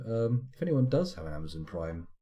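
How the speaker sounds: ordinary talk, nothing marked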